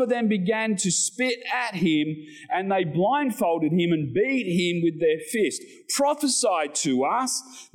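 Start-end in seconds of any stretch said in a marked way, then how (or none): 5.9-7.7 slow, taunting, a touch higher; mild mock jeer. Preacher voicing the guards jeering at Jesus. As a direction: sneer it lightly. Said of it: "they jeered".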